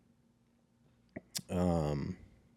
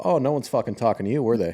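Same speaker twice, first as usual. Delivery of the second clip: higher, quick, cutting retort; retold snapping put-down; 4 semitones higher, 3 dB louder, faster than usual. Grandchild rounds on interfering grandmother, retold snapping put-down. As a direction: shut them down fast with mock sweetness-free scorn. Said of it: "never spoken to my grandmother like that".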